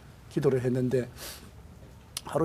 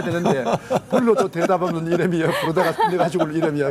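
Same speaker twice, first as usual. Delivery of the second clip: laughing through the words, louder; 3 semitones higher, 6 dB louder than usual. Real, not acted. Pastor laughing while telling of his silent wife. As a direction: chuckle through a joking song line.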